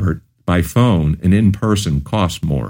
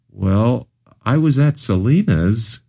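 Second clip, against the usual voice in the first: lightly voiced small girl, rising and unsure; a five-year-old working up the nerve to tell; hint of a hesitant child; hesitant little girl; 7 semitones higher, slower than usual.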